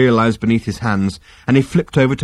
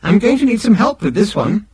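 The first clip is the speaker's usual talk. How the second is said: high-pitched machine, all business now; terse; 11 semitones higher, 3 dB louder than usual. A narrator's reading. Machine stumped by tea, terse high voice. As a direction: drop the cheer and mean business. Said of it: "said the machine tersely".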